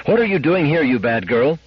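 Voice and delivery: high, scolding mother; anxious anger. A narrator's reading. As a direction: scold the child as worry turns to anger. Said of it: "cried Missus Weston, anxiety dissolving at once into anger".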